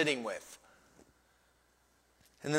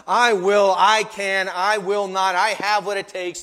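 loud, driving declarations; self-confident; 4 semitones higher, 9 dB louder than usual. Shown as a demonstration. Preacher voicing our self-reliance, loud and self-assured. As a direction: declare it boldly, hitting each phrase. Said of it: "self-confidence must shift from".

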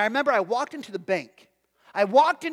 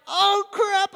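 dismayed realization; 11 semitones higher, 6 dB louder than usual. Retold or imitated. Pastor realizing his blunder.

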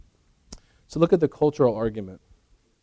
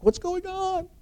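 high, puzzled mock voice of a bewildered churchgoer; confused onlooker; slower than usual. Congregation reacting to the preacher, high puzzled mock voice. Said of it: "Everyone's like".

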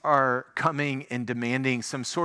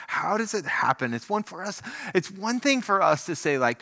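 ordinary talk, nothing marked

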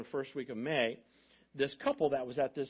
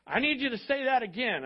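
higher and louder, pressing; urgent loud request; 10 semitones higher, 6 dB louder than usual. Retold or imitated. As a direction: demand it be said again, loudly. Trustee urging pastor to repeat.

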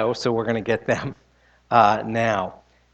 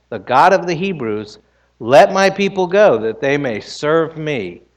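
louder, proclaiming scripture voice; scripture reading; 4 semitones higher, 6 dB louder than usual. Acted. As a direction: read the Lord's words with weight. Reads Exodus aloud in sermon.

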